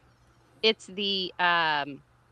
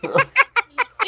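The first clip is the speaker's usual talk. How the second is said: evil cackle; 9 dB louder than usual. Acted. Show opening cackle.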